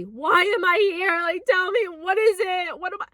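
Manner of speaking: desperate tearful shout, half-remembered; yelling questions at the sky through tears; retold yelling. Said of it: "I'm yelling audibly".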